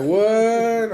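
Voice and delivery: high, loud astonished What?; surprised what. Retold or imitated. Hearing the stranger named Bakersfield, astonished. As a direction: jump up in disbelief. Shocked at same hometown.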